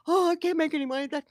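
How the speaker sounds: high, fast whiny salesman voice; mimicking salesman. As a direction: play the put-upon car dealer pleading poverty.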